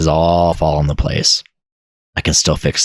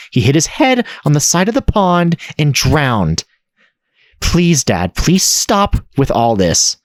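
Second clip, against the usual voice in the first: higher, pleading daughter's voice, slow at the end; 9 semitones higher than usual. Acted. Daughter begging her grieving father to stop, higher and pleading. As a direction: beg your father to stop, near tears.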